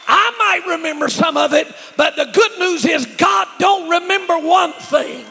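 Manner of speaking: loud, high, drawn-out sermon shouting; full-force preaching. Real, not acted. Preacher telling congregation sins are forgotten, full-force shouting. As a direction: build to a shouted proclamation, word by weighted word.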